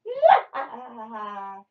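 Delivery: drawn-out villain "mwah-ha-ha"; evil cackle. A narrator's reading. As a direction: cackle like a storybook baddie. Evil pea laughs at heroes.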